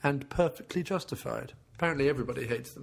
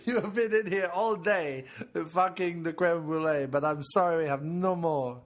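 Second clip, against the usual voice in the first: French waiter; 3 semitones higher than usual. Pretend. Waiter refusing the customer creme brulee, hammed-up French accent. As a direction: play an apologetic waiter in mock French. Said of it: "where the waiter comes out and goes".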